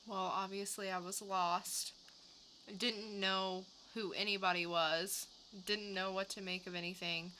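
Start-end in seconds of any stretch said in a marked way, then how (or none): none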